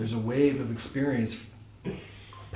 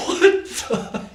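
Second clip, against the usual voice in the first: far higher and louder with a laugh; startled what the; 6 dB louder than usual. Retold or imitated. Reacting to the guard's approval, shocked and laughing. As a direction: yelp it in happy shock. Guard praises his answer.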